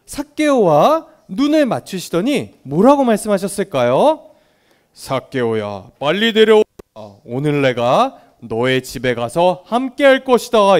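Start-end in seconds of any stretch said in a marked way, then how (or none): 4.9-10.8 lightly voiced Jesus, lower on the name; a hint of Jesus calling him down with authority; Jesus calls Zacchaeus down from the tree, lightly voiced. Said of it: "이렇게 예수님이 말씀하셨어요".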